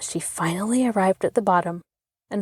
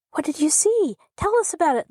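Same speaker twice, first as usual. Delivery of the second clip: put-on much higher, eager clamoring children; 9 semitones higher, faster than usual. A narrator's reading. Children crowding round Rosie, eager high clamor. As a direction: beg for the story all at once. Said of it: "they clamored".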